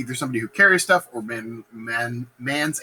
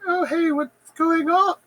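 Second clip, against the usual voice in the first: much higher, slow sing-song innocence; fake innocent; slower than usual. Lady Macbeth arriving, feigning innocence in a high sing-song. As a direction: play the clueless lady sweetly. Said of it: "she's like".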